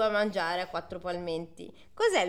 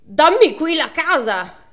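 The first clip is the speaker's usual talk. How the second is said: slow, loud, higher bellowed demand; demanding shout; 7 semitones higher, 11 dB louder, slower than usual. Acted. Greedy suitor bellowing at the Matushka, slow loud shout. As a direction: bark the order slow and loud.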